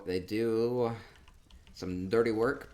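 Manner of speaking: usual speaking voice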